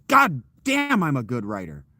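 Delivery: high, loud exclamation falling away; hammed-up boastful yell; mock self-amazed outburst. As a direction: burst out as if stunned by your own talent. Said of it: "That wasn't my reaction".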